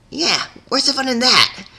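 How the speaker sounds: high teasing tomboy voice; joking. A narrator's reading. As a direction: toss off a joke. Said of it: "Rainbow Dash joked".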